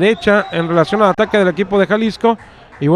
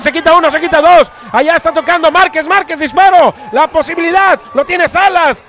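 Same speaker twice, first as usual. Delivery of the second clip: rising play-by-play excitement; 7 semitones higher, 3 dB louder than usual. Real, not acted. Attack building to a shot.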